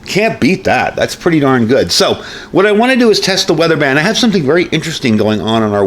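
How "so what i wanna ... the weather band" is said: ordinary delivery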